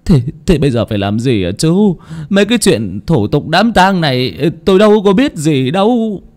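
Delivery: flustered plea climbing high; awkward helplessness. A narrator's reading. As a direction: plead ignorance to the undertaker, voice rising. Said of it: "Ông bà Bửu cũng sượng người ra". (lúng túng phân bua, giọng lên cao)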